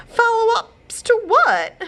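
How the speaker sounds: high, loud, slow wail. A narrator's reading. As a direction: wail it in despair. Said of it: "she wailed".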